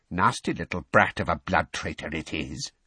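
lightly voiced old elf's sneering aside; audible aside. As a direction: insult him under cover of a bow, but audibly. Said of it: "he added, perfectly audibly".

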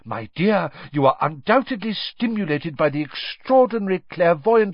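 high, insistent teacher voice; excited seer. A narrator's reading. Professor Trelawney persuading Harry, eager and high.

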